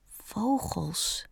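very soft, tentative first word; Bambi repeating slowly. A narrator's reading. Fawn copying his friend's word, repeating slowly. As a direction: try the new word out slowly and quietly. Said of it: "zei Bambi hen langzaam na".

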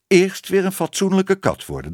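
high, emphatic admonishment; calling out. A narrator's reading. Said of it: "riep tante Moortje". (hoog en nadrukkelijk uitroepend)

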